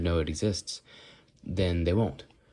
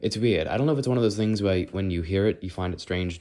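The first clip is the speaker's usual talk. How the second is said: ordinary talk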